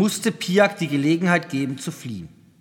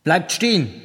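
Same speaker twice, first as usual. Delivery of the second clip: slightly raised interrupting command; cutting in loudly; 2 semitones higher than usual. A narrator's reading. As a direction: override the other speaker firmly. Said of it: "fiel ihm ins Wort und übertönte ihn". (energisch ins Wort fallend)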